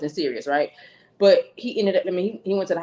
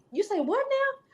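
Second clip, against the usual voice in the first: high, softer incredulous question; taken aback; 14 semitones higher, 5 dB quieter than usual. Retold or imitated. Bride questioning mother-in-law's accusation, taken aback. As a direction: ask it high, as if you misheard. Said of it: "I, I'm taken back".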